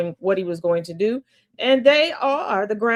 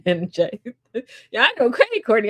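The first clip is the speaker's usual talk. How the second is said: normal talking voice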